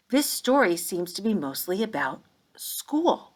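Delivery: ordinary talk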